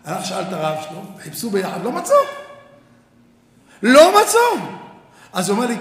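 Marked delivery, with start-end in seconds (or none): 3.8-4.6 louder, higher punch on the repeat; emphatic repeat. Real, not acted. Rabbi repeating to his students, loud emphatic punch. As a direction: land it hard on the crowd.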